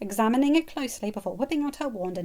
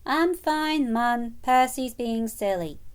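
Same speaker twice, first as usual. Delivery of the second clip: complaining; 4 semitones higher, 5 dB louder, much slower than usual. Acted. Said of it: "Ron complained".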